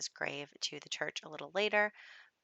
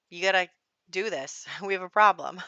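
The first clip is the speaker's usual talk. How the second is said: pushy whim voice; 9 dB louder than usual. Pretend. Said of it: "a whim will show up being like".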